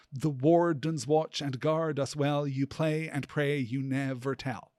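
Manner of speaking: slow, metrical "The wardens watch and guard us well", every second syllable stressed; slow da-DUM rhythm through "You play and pray, you never tell"; iambic scansion. Shown as a demonstration. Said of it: "four pairs of unstressed and stressed".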